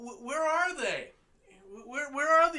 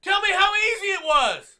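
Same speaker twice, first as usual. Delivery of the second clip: louder taunt; 5 semitones higher, 9 dB louder than usual. Real, not acted. Sarcastic taunt at Hillary.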